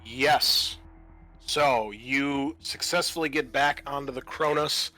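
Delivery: ordinary talk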